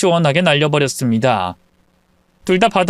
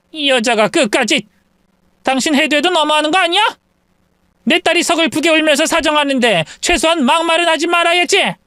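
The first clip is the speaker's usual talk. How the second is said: higher, fast furious retort; angry mother; 11 semitones higher than usual. Acted. Mother answers the in-law, furious. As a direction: hit back at her.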